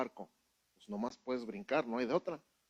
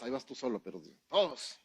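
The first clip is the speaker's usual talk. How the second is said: ordinary talk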